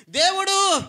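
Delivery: high loud shout. Real, not acted. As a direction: belt the word out. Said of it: "గట్టిగా కొట్టండి".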